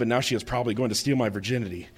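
ordinary talk

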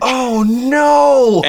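high wailed cry of dismay; mock horror. Co-host reacts to the forum confession, mock horror.

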